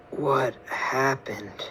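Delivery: soft, slow, barely-there murmur; just coming round, dazed; faint hospital-bed daze; groggy and weak. Acted. Waking up injured in hospital.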